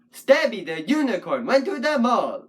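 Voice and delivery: STABby the Unicorn went to the MALL, beats punched; stress on sta, u, mall. Shown as a demonstration. Puppet poet counting syllables, stresses punched on Stabby, unicorn and mall. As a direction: hit STAB, U and MALL. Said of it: "with the emphasis on sta, u, and mall".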